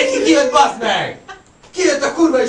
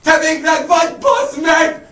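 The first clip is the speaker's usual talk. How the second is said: retold shout; 5 semitones higher, slower than usual. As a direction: yell across to Viktor.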